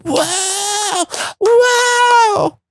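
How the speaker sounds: very high awed whoa; awed gang. Retold or imitated. Gang amazed by the pickle, very high. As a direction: gasp out amazed wonder. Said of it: "they all go".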